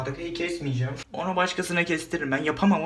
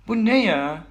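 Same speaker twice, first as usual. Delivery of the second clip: loud high startled shout; shocked cry; 6 semitones higher, 8 dB louder, slower than usual. Real, not acted. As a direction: cry out at a burning sting. Bleach burns, loud cry.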